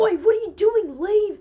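high, loud outraged shout; imitating a friend's furious outburst; angry yell. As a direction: yell at an intruder to get out. Acting out James yelling at him.

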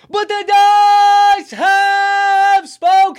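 huge high shout, every word stretched out; booming proclamation. Real, not acted. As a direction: bellow it slowly like a ring announcer's verdict. Proclaims the dice verdict.

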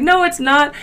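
loud, high mock denial; retold arguing. Friend arguing about Stewie, loud high denial. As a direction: contradict loudly, pitched well up. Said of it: "we, like, argued like that".

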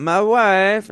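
high, loud catchphrase imitation; Borat. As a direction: belt it out as a hammed-up comic catchphrase. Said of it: "This was the nineties Borat, where everybody did the voice".

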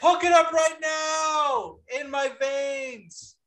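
high, drawn-out wail of delight; loud, hammed-up howl, stretching each word; ecstatic mock shout. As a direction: cry out in over-the-top bliss. Mock ecstatic reaction to co-host's line.

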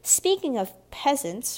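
loud, lifted snobbish retort; slow, stressed sneer at an inferior; smirking scorn. A narrator's reading. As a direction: snap back with haughty disdain. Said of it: "Frances added with a smirk".

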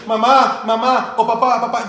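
put-on loud, high child calling Mamá; kid whining. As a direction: nag like an impatient kid. Kid interrupting parent on phone.

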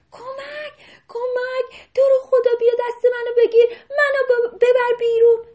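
high, loud desperate begging; panicked plea. Acted. Drowning rabbit begging the deer for help. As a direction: beg for rescue, high and loud. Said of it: "بهش گفت".

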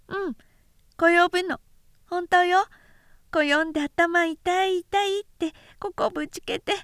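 put-on high, lisping toddler voice; high childish babble; small child. A narrator's reading. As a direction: chirp it like a little boy proud of himself. Toddler insists swallow fell.

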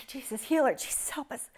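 soft pleading whisper fading to breath; whispered plea over a sick child; desperate whispered prayer. Retold or imitated. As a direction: pray under your breath in terror. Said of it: "I'm just crying out to Jesus".

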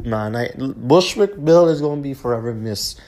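ordinary delivery

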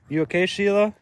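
high, sing-song pet talk; high voice checking on the dog. Real, not acted. Talking sweetly to a dog.

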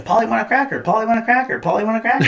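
mock a parrot repeating words. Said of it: "echo chamber of, you know".

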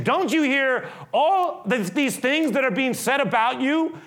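high, loud exasperated demand; frustrated Pilate. Acted. Pilate demanding Jesus answer, high frustrated shout. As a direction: push the question at him, fed up. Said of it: "You can feel his frustration".

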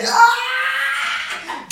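very high squealed scream; piercingly high mock cry of pain; reenacted scream. Reenacts scream when getting cut. (grito agudo de dolor actuado)

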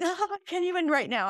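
high-pitched mock overwhelm; playful high-pitched imitation of the kids; imitated exasperated kid. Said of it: "said to my kids when they say things".

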